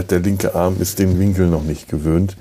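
normal talking voice

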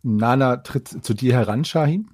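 ordinary delivery

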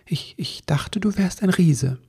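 mildly bashful confession; embarrassed elf. Acted. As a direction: stammer it out a bit sheepishly. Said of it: "sagte Ribbelwitsch ein wenig verlegen".

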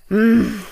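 short loud grunt. A narrator's reading. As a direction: grunt it out drunkenly. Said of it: "grunzt er".

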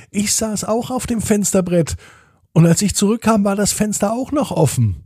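high, eager dream report; excited child. A narrator's reading. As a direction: blurt out the shared dream in amazement. Sister amazed at the same dream.

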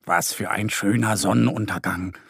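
ordinary delivery

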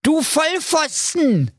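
put-on high, slow Berlin-accent bellow; bellowing ninja. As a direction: roar the insult. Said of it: "brüllte der Ninja".

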